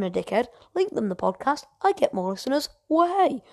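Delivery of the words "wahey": higher, a cheeky whoop; cheeky cheer. Real, not acted. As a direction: celebrate with a silly wahey. Cheeky cheer about more listeners.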